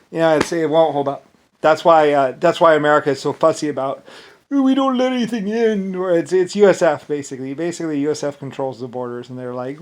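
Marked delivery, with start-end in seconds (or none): 4.5-5.9 higher prissy mock voice; mocking fussiness. Mocking America's border rules, prissy mock voice. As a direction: sneer it like a fussy official.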